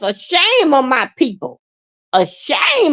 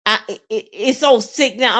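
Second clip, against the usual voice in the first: tearful; 2 semitones lower than usual. Real, not acted. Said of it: "I'm getting so emotional".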